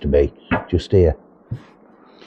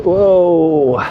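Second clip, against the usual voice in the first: startled whoa; 8 semitones higher, 4 dB louder than usual. Real, not acted. Gust of wind hits him.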